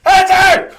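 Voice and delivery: very high, loud mock scream; hammed-up high-pitched outburst; desperate yell. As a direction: shout it frantically at the phone. Recruit yelling at unanswered phone.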